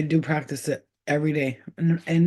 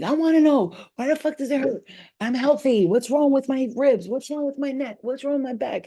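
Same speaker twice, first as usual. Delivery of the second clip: retold yelling; 9 semitones higher than usual. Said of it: "And I'm yelling".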